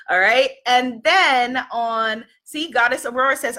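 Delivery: ordinary delivery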